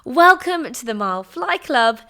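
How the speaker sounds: ordinary talk, nothing marked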